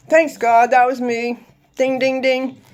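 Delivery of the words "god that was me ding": normal talking voice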